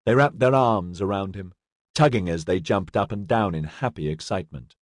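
ordinary delivery, nothing marked

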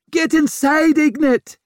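high, loud mother's screech. A narrator's reading. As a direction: screech an urgent order at your child. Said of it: "screeched her mother".

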